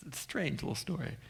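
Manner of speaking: soft unvoiced whisper; hushed confiding aside. Real, not acted. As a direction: lean in and whisper it.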